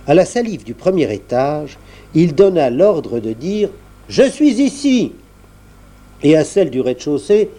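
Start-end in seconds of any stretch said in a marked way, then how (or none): 4.1-5.1 high little voice. A narrator's reading. Salive voice answers.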